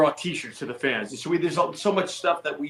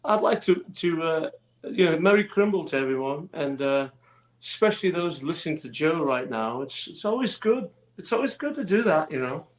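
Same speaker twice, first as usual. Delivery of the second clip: high, bright Scouse [name] voice; [name] Liverpool accent; 3 semitones higher than usual. Pretend. A Liverpool accent for the radio host's listeners. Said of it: "can you wish everybody a happy Christmas in your [name] accent".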